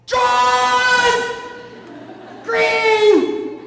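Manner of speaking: Dad bellowing out a sign, drawn out; imitated super-loud shout. Storyteller as Dad reading a sign aloud to the driver, super-loud shout. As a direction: blurt it at full volume, high-pitched. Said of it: "I hear this super loud, my dad say".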